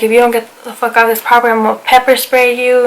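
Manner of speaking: ordinary delivery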